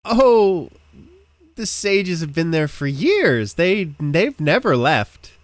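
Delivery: high, loud, awestruck local; effusive villager praising the sages; gushing townsperson. Acted. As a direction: exclaim with big devotion, slowing at the end.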